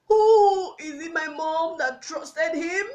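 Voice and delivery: loud, high, anguished outburst; anguished cry. Acted. Child with nobody to talk to, loud anguished cry. Anguished question about whom to tell.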